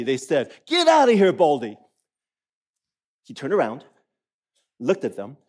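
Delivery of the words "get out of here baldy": mock the bald prophet like a brat; jeering boys. A narrator's reading. Boys jeering at the prophet, loud taunt. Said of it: "jeered at him".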